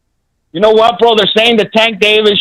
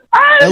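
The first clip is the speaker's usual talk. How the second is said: sudden high falsetto "ay" yelp; mocking effeminate cry. Caller mocks rival fans with a falsetto shriek. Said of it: "Como joto".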